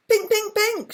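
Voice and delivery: high, loud chirping shout; shouted bird call. A narrator's reading. Chaffinch shouting to Miggy in excitement, high and loud. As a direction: shout it like a proud little finch. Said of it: "shouted the little chaffinch".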